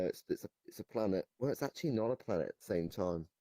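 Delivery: ordinary talk, nothing marked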